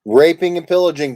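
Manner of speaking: loud, proud card read; loud announcement. Pretend. Host announces the winning card to the table, loud. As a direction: boom it out as the winner.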